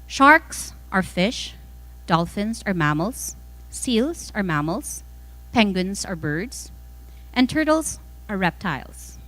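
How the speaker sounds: ordinary delivery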